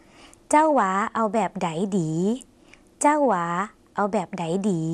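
slow Lao model sentence, phrase by phrase; measured language-teacher demonstration; slow repeat-after-me. Shown as a demonstration. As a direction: say it slowly for learners to join in. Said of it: "ถ้าอยากออกเสียงไปพร้อมกันก็ได้นะคะ".

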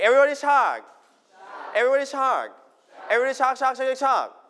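high, chant-like call to the class; rallying call. Real, not acted. (เรียกทั้งห้องเสียงสูงอย่างกระตือรือร้น)

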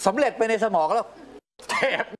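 high, laughing, worked-up retelling; excited laugh. Real, not acted. Father telling the interviewer about nearly losing it, laughing high. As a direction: laugh it out while reliving the urge.

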